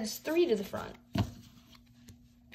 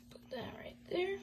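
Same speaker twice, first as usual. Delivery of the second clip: whisper; 4 semitones higher, 20 dB quieter, faster than usual. Real, not acted. Quietly sleeving the card.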